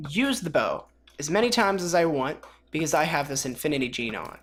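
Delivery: ordinary talk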